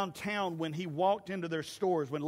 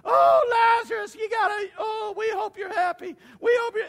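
high, loud mock wailing of grieving mourners; hammed-up crying for the dead; 10 dB louder than usual. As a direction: wail it like a crowd at a graveside.

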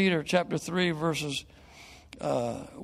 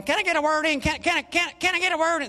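high-pitched Texas twang impression; Ross Perot's Texas twang; 10 semitones higher, faster than usual. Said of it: "talked like this with a Texas twang".

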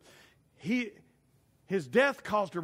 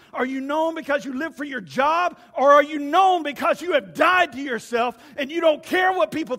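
higher, louder preaching that builds to a shout; fiery preaching; 8 semitones higher, 9 dB louder than usual. Real, not acted. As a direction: drive the questions home to the church. Preaching at full force.

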